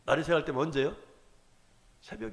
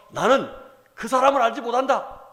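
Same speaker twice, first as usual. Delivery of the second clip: higher, louder sworn denial; vehement denial; 8 semitones higher, 6 dB louder than usual. Retold or imitated. Peter swears to the crowd he doesn't know him, loud vehement denial. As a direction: swear it out loud, pitch climbing. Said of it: "라고 부인해버렸다는 거예요".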